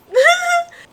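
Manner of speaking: real laugh, pitched high. Real, not acted. Vlogger laughing at her own mock panic, high laugh. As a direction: laugh at your own dramatics.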